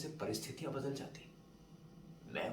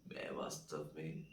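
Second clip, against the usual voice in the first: pondering; 6 semitones lower, 6 dB quieter than usual. Acted. Narrator asks himself who he really is, pondering.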